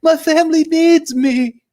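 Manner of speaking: high, loud, drawn-out mock wail; hammed-up pleading, slow and very high; freaking out. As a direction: imitate a desperate man falling apart. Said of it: "he's, like, freaking out".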